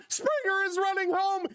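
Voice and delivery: rising to a high, thrilled yell; excited home run call. Real, not acted. Play-by-play streamer losing it over the home run, excited. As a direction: call it like the whole park is on its feet.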